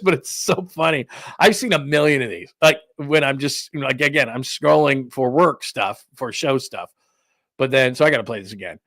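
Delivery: ordinary delivery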